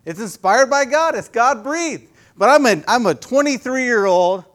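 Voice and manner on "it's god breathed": ordinary talk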